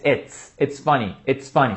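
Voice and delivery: "it's" ending in a sharp s, not z; s sound demo. Shown as a demonstration. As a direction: hiss the s of "it's". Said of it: "com som de S".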